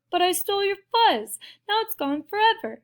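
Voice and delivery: high-pitched regretful wail; crying out. A narrator's reading. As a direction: lament it in a high voice. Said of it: "Big Bark cried".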